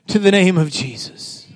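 high, shouted declarations; rousing preacher building to a high, sustained cry; full-force preaching. Real, not acted. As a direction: proclaim it over the congregation.

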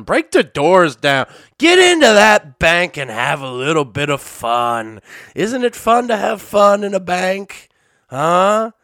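loud, high-pitched hammed-up rallying cry; mock rabble-rousing. Cheering French protesters into the bank, loud mock incitement. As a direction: egg on the rioters in a playful shout.

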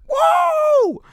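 loud high gym-pumped yell; pumped-up shout. Retold or imitated. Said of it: "my blood is pumping and I'm like".

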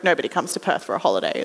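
low, quick deadpan aside; mock dismissive reply. Speaker answering the mother about Perth, mock dismissive. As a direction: drop it low and rattle it off.

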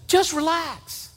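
high, slow soothing voice; calm me down gently; friend calming. Retold or imitated. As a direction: pastor friend soothing a frustrated preacher. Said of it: "Les would say".

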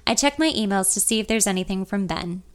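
ordinary delivery, nothing marked

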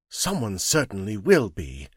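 usual speaking voice

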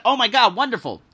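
high, loud burst of delight; retold excited exclamation. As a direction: react thrilled to good news. Retold delight at friend's news.

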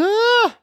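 very high imitated wail of a child; mock a toddler's protest cry; son's wail. Dad imitating his son flopping down at school, high mock wail. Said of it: "He goes".

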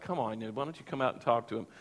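low, quiet, weary police officer; lightly acted eye-rolling plea under the breath; mock exasperation. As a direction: imitate a fed-up officer, softly and low. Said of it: "they would just roll their eyes like".

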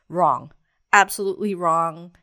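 loud, punchy contradiction; emphatic correction. Real, not acted. Mom correcting her hopes for the flight, emphatic. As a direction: knock down the hopeful idea forcefully. Bluntly overturns her naive thought.

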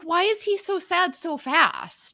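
baffled, stretching out the words; puzzled reaction. Retold or imitated. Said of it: "I'm like".

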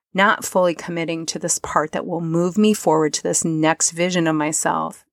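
ordinary talk

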